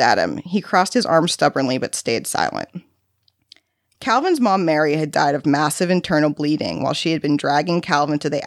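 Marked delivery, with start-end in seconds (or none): none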